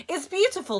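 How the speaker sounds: put-on a high, loud Egyptian-accented Sale; lightly done Cairo marketplace accent, proud; heavy Cairo accent. A narrator's reading. Villain Sale shows the boy his jellyfish, Cairo accent. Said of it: "He had a heavy accent brought with him from the Cairo marketplace".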